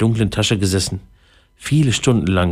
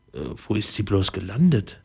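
plain reading voice

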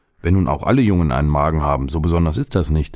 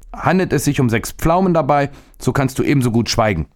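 put-on higher, quick defiant boy voice; boy brushes off the stomach's offer, high and quick; 7 semitones higher than usual. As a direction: cut him off, cheeky and high. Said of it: "erwiderte der Junge".

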